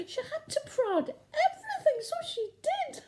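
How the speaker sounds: high, giggly voice; tittering; giggling. Acted. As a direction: laugh through the words. Giggling about the prodding.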